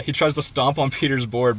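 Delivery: ordinary talk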